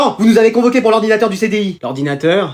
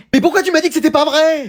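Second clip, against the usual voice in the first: high, protesting question; indignant reproach; 7 semitones higher than usual. Acted. Parent misled about the alarm, indignant reproach. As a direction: demand an answer at a raised pitch.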